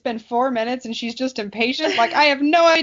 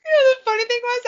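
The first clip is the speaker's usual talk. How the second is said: laughing; 9 semitones higher, much faster than usual. Real, not acted. Still laughing.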